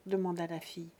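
ordinary delivery

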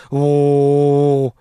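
a low, drawn-out mock bailiffs' cry; courtroom chant. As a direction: boom it like runners intimidating the court. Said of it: "两旁边一喊唐威".

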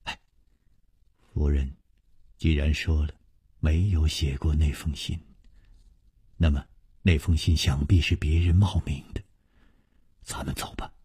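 low, hushed urging, fading to a whisper. A narrator's reading. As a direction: pull him aside and speak under your breath. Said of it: "悄悄地一拉". (悄悄地低声劝说)

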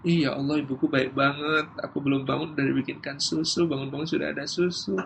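soft, touched murmur of gratitude; grateful child. Acted. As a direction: play a just-woken child quietly marvelling at mum. Acted grateful child just woken. (anak bersyukur dengan suara pelan)

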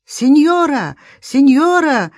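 high, loud call with falling end; drawn-out hailing cry, pitched high. A narrator's reading. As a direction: shout to someone out on the water. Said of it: "крикнула моя подруга".

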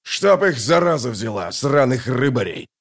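grumbled curse from an irritated man; understated angry cursing. A narrator's reading. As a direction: swear at them with mild heat. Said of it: "выругался Краханкрайт".

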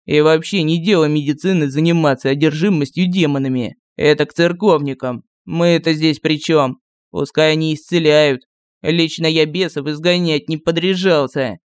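ворчливый лекарь. A narrator's reading. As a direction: grumble like a sour physician. Первый лекарь ворчит. (ворчливый старый лекарь)

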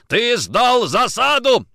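slow, loud, high accusing yell; furious bellow. A narrator's reading. Nikitin beating Kochkin, furious bellow. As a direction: yell it out word by word. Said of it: "орал Никитин".

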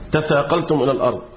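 ordinary talk, nothing marked

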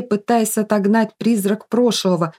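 ordinary delivery, nothing marked

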